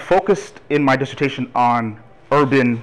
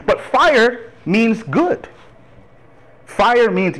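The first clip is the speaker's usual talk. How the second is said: ordinary talk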